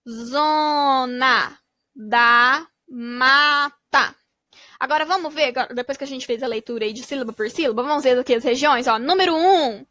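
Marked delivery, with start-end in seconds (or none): 0.1-4.2 very slow "Zona da Mata", every vowel held long; slow stretched reading. Shown as a demonstration. Teacher reads the blended name slowly to kids. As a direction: draw each syllable out for the class. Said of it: "blending syllables into the word".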